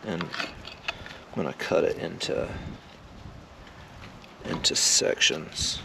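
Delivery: slow whisper, no voice. Real, not acted. Talking to himself over the roast, whispered. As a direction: breathe the words out slowly. Repeats the cutting plan under breath.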